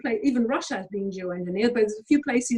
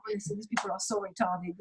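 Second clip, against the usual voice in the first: ordinary delivery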